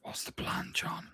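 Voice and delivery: very soft, secretive whisper. Acted. Player asking John for the plan near the creatures, whispered. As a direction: whisper the question to your companion. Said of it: "I'm gonna whisper to John".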